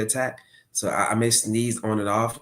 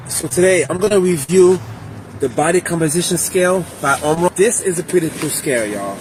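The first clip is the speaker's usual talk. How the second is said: recorded clip; 9 semitones higher, 8 dB louder than usual. Real, not acted. Video clip of own review.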